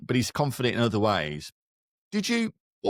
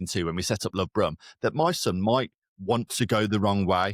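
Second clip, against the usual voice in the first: usual speaking voice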